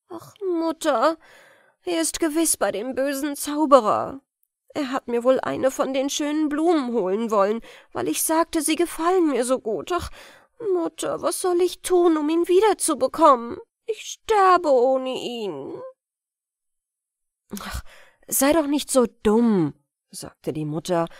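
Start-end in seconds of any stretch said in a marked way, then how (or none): 0.1-15.8 put-on higher, pleading girl in despair; a girl's worried lament, higher and louder; anxious young girl. Sister fears for brother. 17.6-19.7 louder, impatient mother; a hard, dismissive mother; scolding mother. Acted.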